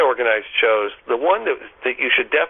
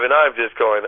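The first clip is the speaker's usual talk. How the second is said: usual speaking voice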